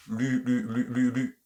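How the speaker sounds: Lu with lips pushed forward and rounded; rounded lu drill. Shown as a demonstration. Teacher drilling lu, lips pushed forward. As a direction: push the lips out on "Lu". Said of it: "Avancez les lèvres".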